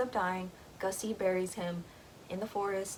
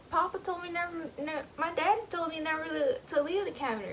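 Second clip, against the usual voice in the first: put-on childlike protest; 9 semitones higher than usual.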